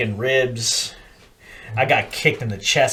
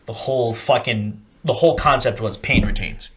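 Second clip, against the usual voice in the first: normal talking voice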